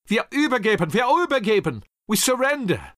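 defeated soldiers crying out to surrender; shouting surrender. A narrator's reading. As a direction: call out the surrender over the guns.

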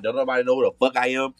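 ordinary talk, nothing marked

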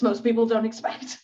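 ordinary delivery

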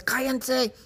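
submissive man's high, ineffectual yell; mock yell. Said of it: "Stewie siempre les gritaba a las verrugas".

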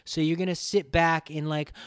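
ordinary delivery

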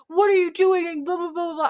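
mock irate demand. Impatient customer to worker switching things on, high mocking demand. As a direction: imitate a pushy customer, trailing into gibberish.

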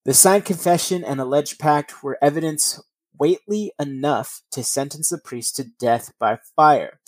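ordinary delivery

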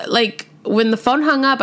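ordinary delivery

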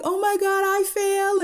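high-pitched shocked retelling. Retold or imitated. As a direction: squeal it out in alarm. Friend retelling shocked first sight.

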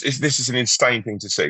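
ordinary talk

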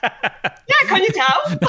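high, amused quoted reply; laughing comeback. Retold or imitated. Guest retells answering surprised strangers, laughing high. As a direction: toss it back playfully through laughter. Retold comeback about her accent.

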